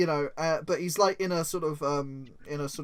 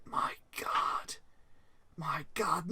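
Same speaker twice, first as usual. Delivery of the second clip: hushed, much softer gasping whisper; shocked whisper; 10 dB quieter, slower than usual. Acted. Employee alone in a dark room reading secret files, hushed horrified whisper. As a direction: breathe it out in horror over the files.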